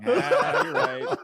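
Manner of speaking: high giggling agreement; laughing high reply. Retold or imitated. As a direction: crack up while answering. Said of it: "retold kid reply".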